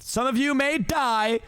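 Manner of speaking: high, slow grand pronouncement; pompous lord. Acted. Farquaad warning the knights, high slow declamation. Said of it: "who said that".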